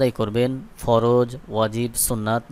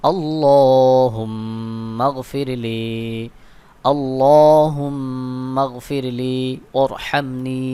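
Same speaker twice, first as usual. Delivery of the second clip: melodic recitation; much slower than usual. Shown as a demonstration. Teacher leading viewers in a slow memorizing chant. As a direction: chant it like a repeated lesson, stretching each word. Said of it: "গুন্নাহ করতে হবে".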